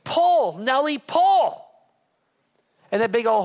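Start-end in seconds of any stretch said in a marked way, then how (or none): 0.0-1.6 loud, high, drawn-out command to a horse; hollered farmer's command, stretched out; farmer's yell. Retold or imitated. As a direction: call out long and loud to a workhorse. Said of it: "the farmer yells out".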